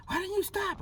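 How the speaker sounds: high-pitched mock-frantic demand; freaking out. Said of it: "she's all freaking out".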